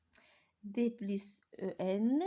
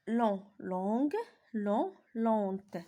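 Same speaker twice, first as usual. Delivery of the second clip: "long, longue" said slowly and louder; louder, slow teacher drill; slow louder repetition; 3 dB louder, much slower than usual. Shown as a demonstration. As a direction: drill the pairs for the class. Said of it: "Je répète".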